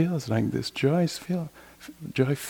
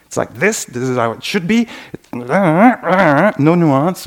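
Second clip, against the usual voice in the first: louder, slowing hard on no nuance, rigid anger; angry certainty; 4 semitones higher, 7 dB louder, slower than usual. Retold or imitated. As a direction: voice the mind caught up in anger. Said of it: "thoughts that are produced by anger".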